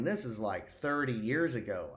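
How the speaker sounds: ordinary talk, nothing marked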